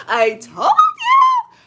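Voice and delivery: very high, loud, drawn-out squeal; triumphant I-told-you-so. Real, not acted. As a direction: shriek it slowly in triumph. Gloats that her tip worked.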